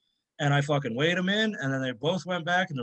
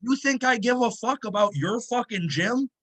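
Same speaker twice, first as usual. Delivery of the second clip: mock furious rant; 8 semitones higher than usual. Lifter retelling his comeback to gym owner, hammed-up fury. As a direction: tear into him like you've had enough.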